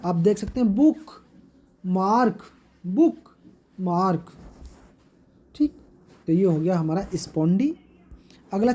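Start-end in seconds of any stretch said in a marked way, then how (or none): 0.8-4.3 बुक, mark as separate stressed beats; both syllables stressed. Shown as a demonstration. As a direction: pause between बुक and mark, weight on each. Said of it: "हम stressed बोलेंगे".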